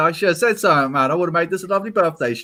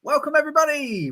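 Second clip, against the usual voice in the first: excited greeting; 11 semitones higher than usual. Real, not acted. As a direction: hype up the viewers with a big hey. Streamer greets viewers loudly.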